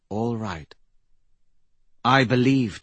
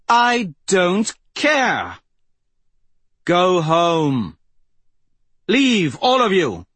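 defiant; 8 semitones higher, 6 dB louder, slower than usual. Acted. Aryan dismisses the prince.

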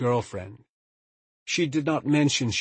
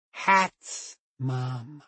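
put-on little girl voice; 3 semitones higher than usual. A narrator's reading. Small child voiced in story.

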